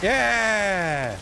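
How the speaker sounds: loud, high drawn-out yell; triumphant cheer. Real, not acted. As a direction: cheer it out as you finally make it. Cheers on reaching the goal.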